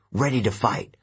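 plain reading voice

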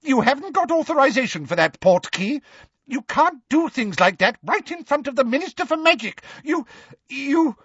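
high, indignant protest petering out; flustered official losing his nerve; blustering, then faltering. A narrator's reading. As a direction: protest shrilly, then stammer to a halt. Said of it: "his voice faltered".

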